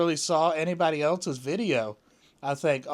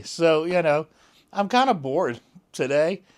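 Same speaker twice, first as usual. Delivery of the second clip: ordinary talk, nothing marked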